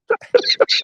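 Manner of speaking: bursting high-pitched laugh. Real, not acted. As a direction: laugh out loud at the punchline. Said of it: "laughs at his own story".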